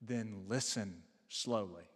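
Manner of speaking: slow, low and deliberate quote; daughter's earnest request. Retold or imitated. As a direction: lightly imitate a child's earnest plea, spoken slowly. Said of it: "She looked at him and she said".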